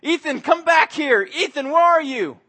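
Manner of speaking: high, loud frantic calls for a lost son; yelling the boy's name in a panic; panicked screaming. Retold or imitated. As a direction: scream for your missing child. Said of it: "I was screaming at the top of my lungs".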